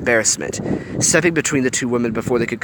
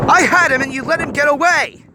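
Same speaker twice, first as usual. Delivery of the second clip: furious yell; 6 dB louder than usual. A narrator's reading. Hiro furious at Fred.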